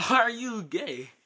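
usual speaking voice

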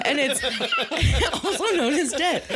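high, laughing through the words. Real, not acted. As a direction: giggle out the punchline. Laughing at TV mistake.

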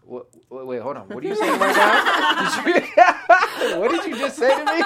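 high, loud, laughing mock outrage; laughing fake-shocked partner; shocked disbelief. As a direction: play the fiancée caught off guard, laughing. Fiancée's shocked reaction, retold.

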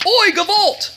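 high-pitched mock cry; comic exclamation. Storyteller capping the battle, high comic exclamation. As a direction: throw it out as a comic Yiddish wail.

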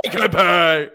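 mock yelling. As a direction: holler it, hammed up. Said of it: "She's yelling".

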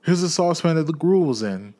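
high, loud excited cry; old man pointing out his room, delighted. Acted. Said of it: "cried Scrooge".

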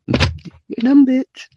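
high, teasing "You're dumb, bitch"; high mocking insult. Insulting the girl twice.

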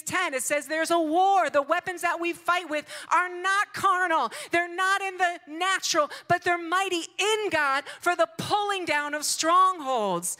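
high, loud, emphatic preacher's delivery; full-force preaching. Real, not acted. Preacher urging grace for the troubled, full-force preaching. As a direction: proclaim it to the congregation, loud and high.

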